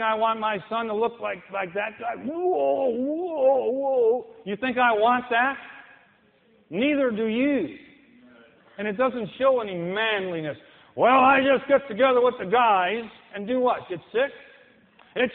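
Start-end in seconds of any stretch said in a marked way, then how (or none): 2.2-4.3 loud, higher whoa of alarm; mock alarm. Preacher recoiling at the drunk man, loud mock alarm. 11.0-13.0 loud, higher mocking voice; mock excuse. Preacher mimicking a drinker's excuse, loud and mocking. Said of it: "quoted excuse".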